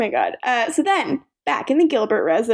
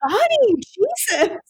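mock shocked; 11 semitones higher, slower than usual. Narrator reacting in character.

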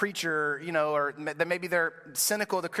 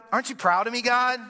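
more eager; 7 semitones higher, 5 dB louder than usual. Retold or imitated. Believer seeking God's praise.

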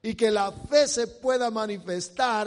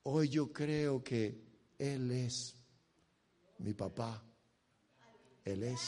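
low, soft preaching voice; soft, sincere declaration of faith; hushed testimony; 7 semitones lower, 6 dB quieter than usual. Real, not acted. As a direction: confide it quietly, with pauses. Preacher drops to quiet testimony.

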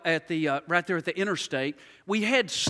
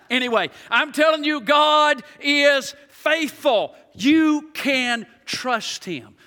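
high, loud and very slow declaration; emphatic preaching; 6 semitones higher, 6 dB louder, much slower than usual. Acted. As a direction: drive each word home. Back to preaching force.

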